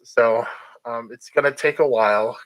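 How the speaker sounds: usual speaking voice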